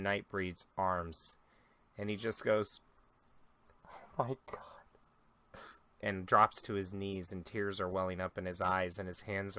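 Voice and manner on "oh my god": slow, voiceless, horrified whisper; devastated whisper. Acted. Grieving father seeing his dead son, slow whisper. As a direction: let it fall out of him in a slow whisper.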